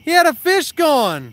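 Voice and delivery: exasperated complaint. Real, not acted. Complaining friend lost the fish.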